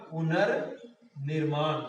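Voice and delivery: पुनर्निर्माण drawn out, पुनर् apart from निर्माण; slow dictation. Shown as a demonstration. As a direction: say पुनर्निर्माण at half speed, in two parts. Teacher spells out the joined word slowly.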